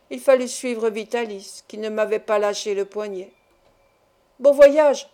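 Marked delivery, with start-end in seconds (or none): 4.4-5.1 loud mocking farewell shout. A narrator's reading. Man shouting a mocking farewell from the door. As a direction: yell it after them. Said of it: "cria Barberin".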